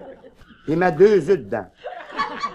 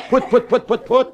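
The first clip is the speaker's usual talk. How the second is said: putt-putt plane noise; 3 semitones higher than usual. Retold or imitated. Imitating small Cessna planes.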